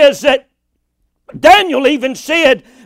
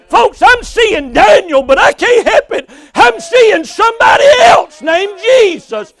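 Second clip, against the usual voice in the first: raised, higher-pitched preacher's cry; full-force preaching; 5 semitones higher, 4 dB louder than usual. Real, not acted. Preacher telling folks he sees Jesus, full-force preaching. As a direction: preach it out with rising fervour.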